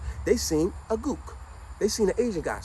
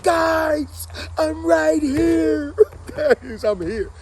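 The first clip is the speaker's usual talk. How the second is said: tearful plea; 11 semitones higher, 5 dB louder, much slower than usual. Retold or imitated. Unseen, pleading with friends.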